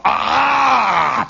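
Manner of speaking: high, loud imitated scream of torn corn; a mock scream from the cornfield; corn ear scream. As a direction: shriek like an ear ripped off the stalk. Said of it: "those corn ears scream".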